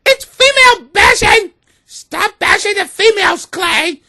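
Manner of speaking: high, loud mock crying woman. Imitating offended women scolding Clay, high mock wail. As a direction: wail it as a hurt caricature.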